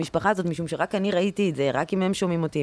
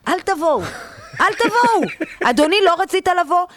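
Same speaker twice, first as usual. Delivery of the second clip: mock yell; 8 semitones higher than usual. Storyteller mock-yelling at unruly fathers on outings. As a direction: bark it at the bad dads, hammed up.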